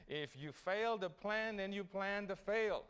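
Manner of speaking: slow, weighty, every word landed; slow preacher's punchline; slow emphatic. Real, not acted. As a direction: spell out the maxim slowly.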